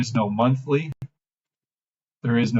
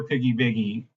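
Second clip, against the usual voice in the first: ordinary delivery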